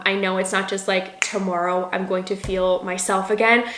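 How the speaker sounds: ordinary delivery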